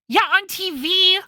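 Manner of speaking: exasperated Maya. Acted. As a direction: stress it impatiently.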